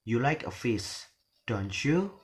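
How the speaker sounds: pause, then swing "don't you" upward; rising question tag. Shown as a demonstration. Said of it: "the rising".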